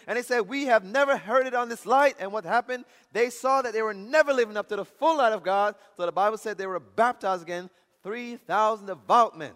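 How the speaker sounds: loud, high-pitched sermon delivery; loud, impassioned pulpit proclamation; full-force preaching. Real, not acted. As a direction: preach it out with rising fervour.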